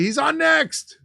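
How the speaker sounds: loud, high-pitched yell; urgent shout. Acted. Show host joking about the horse backstage, shouted. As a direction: call it out at the top of your voice. Acts out a panicked stage call.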